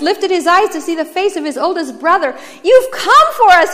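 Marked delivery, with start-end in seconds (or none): 2.6-3.7 much higher and louder cry; high, ringing exclamation; joyful shout. A narrator's reading. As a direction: shout out at the sight of your rescuer. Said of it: "he shouted".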